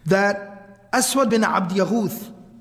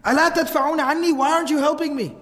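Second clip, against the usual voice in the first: higher, hurried pleading without real shouting; retold frantic plea; 7 semitones higher, faster than usual. Frightened father begs his family for help, retold frantic plea. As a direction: act out a panicked plea, quick and high. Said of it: "And he keeps on screaming".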